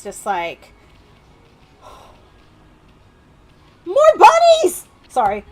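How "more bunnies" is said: delighted squeal. Real, not acted. Spotting bunnies in game.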